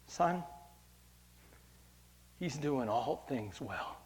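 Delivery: soft, slow words of a dying woman, retold; pained testimony. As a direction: say it softly and slowly, through mild pain. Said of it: "in intense pain".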